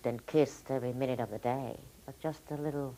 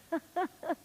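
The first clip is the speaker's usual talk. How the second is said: high, short peal of laughter; stage laugh. Acted. Actress playing Molly Bloom on stage, high laugh.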